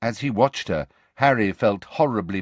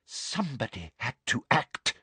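hushed, slightly raised pitch; breathed; 4 semitones higher, 5 dB quieter than usual. A narrator's reading. Umbridge justifying herself to Harry, breathed softly. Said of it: "breathed Umbridge".

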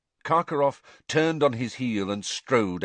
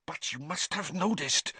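much higher, anxious and pressing; agitated voice; 8 semitones higher, 3 dB quieter than usual. A narrator's reading. Karkaroff pleading with Snape, anxious and agitated. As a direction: worry spilling over. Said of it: "in an agitated voice".

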